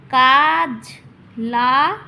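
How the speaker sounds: loud syllables with a clear gap; separate syllables. Shown as a demonstration. Teacher showing two separate beats, loud split syllables. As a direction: set each syllable firmly apart. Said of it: "আলাদা আলাদা".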